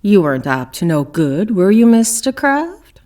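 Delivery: loud, rising accusing question; loud interrogator's challenge; pressing question. Acted. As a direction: lean in hard on the suspect. Detective presses suspect in interrogation.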